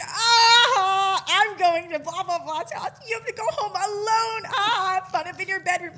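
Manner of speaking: high, loud teasing yell with a cry in it; mocking shout. Friend shouting mockery at Brandon as he's dropped off, loud and high. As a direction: yell and taunt him like a bully. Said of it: "Like, I'm bullying him".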